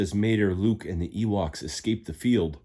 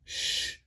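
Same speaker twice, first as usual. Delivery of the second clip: hush; 7 dB quieter than usual. Acted. Sneaking up on tractors.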